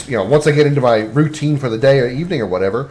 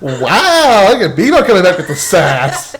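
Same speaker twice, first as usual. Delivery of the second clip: laughing, pitched up; 6 semitones higher, 4 dB louder, slower than usual. Real, not acted. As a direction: talk through laughter at the sass. Laughing at friend's comeback.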